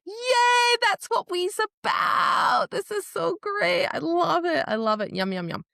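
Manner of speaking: high, loud shouted glee; squealing joy. Retold or imitated. Fan squealing with joy at the friends' book chat. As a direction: cheer it out like a delighted fan. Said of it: "And I'm just like".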